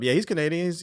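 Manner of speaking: usual speaking voice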